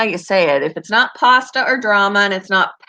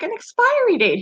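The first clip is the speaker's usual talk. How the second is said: expiry date said much higher; repeating it patiently, high; high insistent repeat; 13 semitones higher than usual. Retold or imitated. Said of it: "I'm like".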